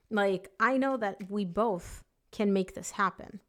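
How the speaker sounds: "both" slowed and leaned on; "both" drawn out and stressed. Real, not acted. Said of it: "Emphasis on the both".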